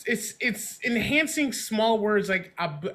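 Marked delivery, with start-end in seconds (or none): none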